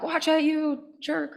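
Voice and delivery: high, slow, drawn-out mock outburst at a driver; road rage at a driver. Walking to work, nearly hit by a car, she yells at the driver. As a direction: snap at the careless driver, stretched out and high. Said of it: "and now I'm like".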